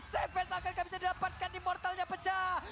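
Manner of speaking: loud, rising play-by-play hype; fighting game announcer. Acted. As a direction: call the teamfight at full force. Caster hyped as fight climaxes.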